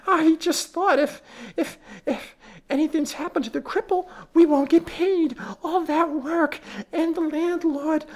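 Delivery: high, wailing panic over lost pay; faint shriek. Acted. Housekeeper suddenly fearing her husband won't be paid, wailing high. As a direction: let the fear rise into a thin wail. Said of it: "uttered a faint shriek".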